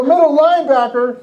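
high-pitched incredulous echo; mock dismay. Linebacker learning the rival wants his position, dismayed. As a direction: echo it in alarmed disbelief.

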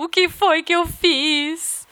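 high, slow mock despairing mother; a touch of parental regret, drawn out. Friend mocks her own regret at being a mother, mock despair. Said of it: "Ela fala assim".

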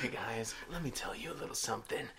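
very quiet, intimate murmur; soft sultry intro. Acted. Redoing the podcast intro, hushed confiding voice. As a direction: lean in and confide softly.

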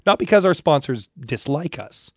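ordinary talk, nothing marked

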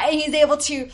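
usual speaking voice

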